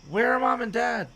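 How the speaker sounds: high, loud question; high, anxious kid; urgent question. A narrator's reading. Child demands to know of parents.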